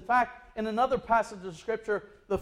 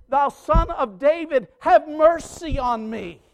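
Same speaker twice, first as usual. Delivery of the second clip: crying out; 8 semitones higher, 9 dB louder than usual. Retold or imitated. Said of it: "was crying out".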